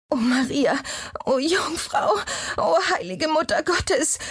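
very high, anguished plea in labour; forced-out outcry. A narrator's reading. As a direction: force out a high-pitched prayer through pain. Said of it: "Das Mädchen stieß die Worte aus".

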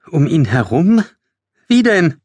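high, incredulous questioning; Emma. A narrator's reading. Emma questions the plan to skirt the Hollow, high and incredulous. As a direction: pitch leaps up in alarmed doubt. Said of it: "fragte Emma".

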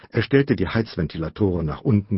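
usual speaking voice

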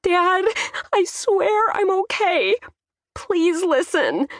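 high, drawn-out tearful plea; wailing. A narrator's reading. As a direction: wail it slowly to a frantic dad. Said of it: "only made me wail harder".

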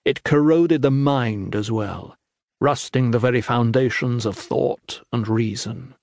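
ordinary delivery, nothing marked

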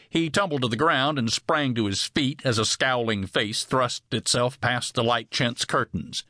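usual speaking voice

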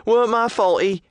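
put-on high, loud young woman shout; yelling lass. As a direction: yell it at the fence.

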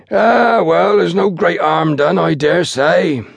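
high, slow, grand pronouncement; magnanimous. A narrator's reading. Station master pardons his porter, magnanimous. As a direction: forgive with drawn-out pompous generosity. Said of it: "became magnanimous".

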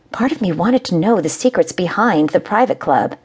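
plain reading voice

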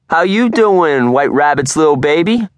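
loud, high, very slow taunting call; mocking yell. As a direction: drag it out to get a laugh from the team. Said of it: "Terrell yells to Erin".

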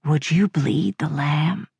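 low, slow, hushed eerie voice; metallic grinding voice. A narrator's reading. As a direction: drag the question out low, like a grinding machine. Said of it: "The metallic grind finally formed words".

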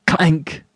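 single high clank; basin clank. A narrator's reading. Narrator imitates basin reaching first floor, high clank. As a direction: imitate metal landing, pitched high.

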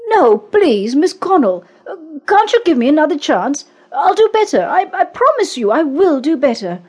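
high, pleading begging. A narrator's reading. Man begging his boss to keep his job, pleading. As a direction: beg for the job, embarrassed. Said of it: "with blushing cheeks repeated himself".

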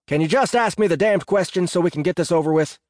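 fed-up complaint; loud impatience. A narrator's reading. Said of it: "I said loudly".

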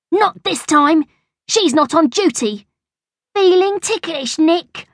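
high-pitched schoolboy gloating; acted playground menace, pitched high; gloating threat. A narrator's reading. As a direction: taunt your cornered enemy. Bertie threatens cornered Nick with tickling.

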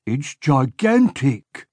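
a slow, lightly voiced awed henchman; mild awe, slow; awed henchman Bone. A narrator's reading. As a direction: say it wide-eyed. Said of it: "Bone said".

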